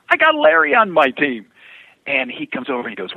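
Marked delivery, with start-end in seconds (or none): 0.1-1.5 loud, high crowing announcement; acted boast. Driver bragging to rival about his teammate, acted loud boast. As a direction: call it out as a playful retold brag.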